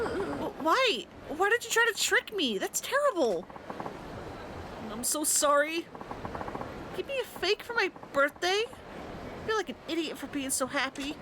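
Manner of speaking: put-on a betrayed girlfriend who feels foolish; hurt girlfriend.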